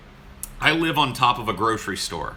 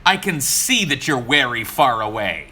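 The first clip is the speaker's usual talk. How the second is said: exasperated; 4 semitones higher, 5 dB louder than usual. Retold or imitated. Fed-up rider arguing with driver.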